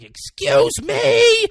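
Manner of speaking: very high, loud, drawn-out mock screech; imitated yelling. Bus driver to the man ignoring her, imitated yell. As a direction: yell to get his attention, hammed up. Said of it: "is yelling".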